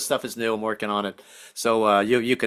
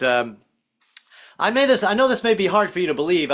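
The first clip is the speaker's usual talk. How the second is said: ordinary talk, nothing marked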